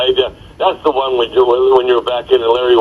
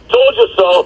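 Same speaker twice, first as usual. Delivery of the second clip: retold yell; 9 semitones higher than usual. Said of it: "turns towards the Phoenix bench and yells".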